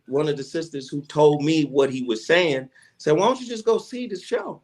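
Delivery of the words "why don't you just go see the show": much higher, coaxing; woman's voice. Retold or imitated. Sister from the office urges him, in a higher voice. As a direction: pitch it up like a woman egging him on.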